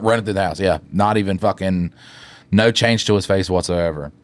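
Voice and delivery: ordinary talk, nothing marked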